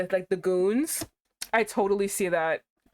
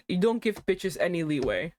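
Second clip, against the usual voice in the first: ordinary delivery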